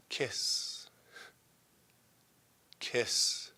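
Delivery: soft, breathed-out word; hushed kiss. Acted. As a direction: let it out almost as a whisper. Kisses punctuating a love scene.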